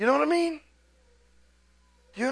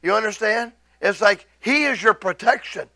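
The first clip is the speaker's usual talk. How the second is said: ordinary talk, nothing marked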